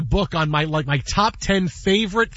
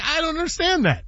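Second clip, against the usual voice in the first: usual speaking voice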